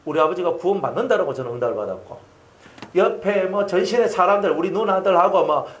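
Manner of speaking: usual speaking voice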